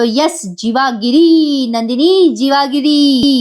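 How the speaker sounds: slow, stretched-out declaration; playful proclamation. Retold or imitated. Suitor announcing his wooing plan to Nandini, playful proclamation. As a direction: draw the coined word out like a slogan, hammed-up.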